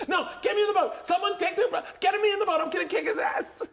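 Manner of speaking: very high shouting imitation; mock frantic fury. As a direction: yell for rescue while threatening the driver. Stranded friend appeals to others.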